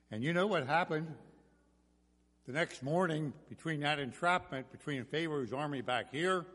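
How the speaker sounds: ordinary delivery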